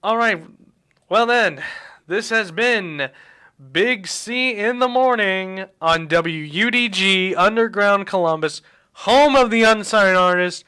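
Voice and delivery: big, loud radio DJ sign-off, drawn out; radio announcer. Acted. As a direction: boom the station outro like a morning DJ. Radio host signing off the show.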